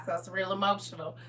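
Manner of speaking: ordinary talk, nothing marked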